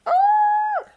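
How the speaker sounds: very high, loud "ooh"; high-pitched excited whoop; excited squeal. Real, not acted. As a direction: squeal with sudden delight. Squeals at her topic.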